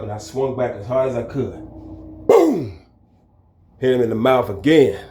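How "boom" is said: punch sound effect. Retold or imitated.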